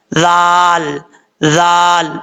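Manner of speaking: जाल said crisply with the tongue at the teeth, no whistle; letter demo. Shown as a demonstration. Teacher modelling the letter twice for students. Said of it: "इस तरीके से पढ़ेंगे".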